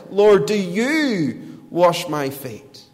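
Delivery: slow, emphatic question with YOU drawn out; "you" pushed and lengthened in "Lord, do you wash". Acted. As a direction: lean hard on "you", stretching it in disbelief. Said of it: "Peter's words are emphatic".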